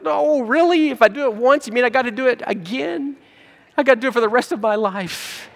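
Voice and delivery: high mock whine of a reluctant giver; whiny complaint. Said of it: "It's like".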